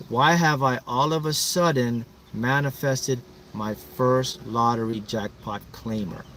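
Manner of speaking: slow, deliberate recitation; measured affirmation. Shown as a demonstration. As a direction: spell out the affirmation slowly, word group by word group. Models affirmation for group to say.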